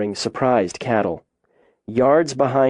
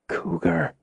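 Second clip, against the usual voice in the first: hushed, higher, frightened word; barely breathed; 5 semitones higher, 5 dB quieter than usual. A narrator's reading. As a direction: breathe it out softly, scared to move. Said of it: "She barely breathed the word".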